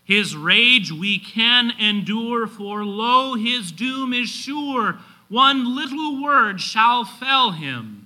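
slow, high, ringing hymn recitation; measured, triumphant pulpit declamation; preaching at full force. Acted. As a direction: declaim the hymn verse loudly, line by line.

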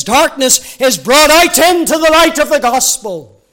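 loud, high, impassioned preaching; fervent preaching. Acted. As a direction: lift the voice and preach it out. Preaching at full force.

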